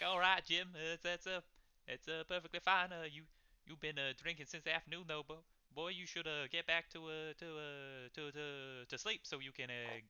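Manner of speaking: halting, stammering character voice; placating innkeeper. Acted. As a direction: hem and haw while soothing a drunk.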